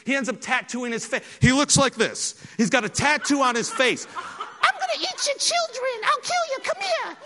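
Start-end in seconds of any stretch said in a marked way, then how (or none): 4.2-7.2 squeaky falsetto menace; comic impersonation, absurdly high-pitched threats; Mike Tyson. Retold or imitated. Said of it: "he talks in this squeaky voice".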